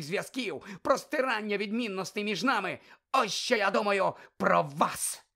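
loud high angry tirade; loud furious accusations; furious accusing rant. Acted. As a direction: rage at Dumbledore, spitting words.

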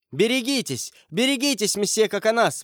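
high-pitched urgent alarm; exclaimed warning. A narrator's reading. Lady warns her champion of danger, exclaiming. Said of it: "воскликнула дама".

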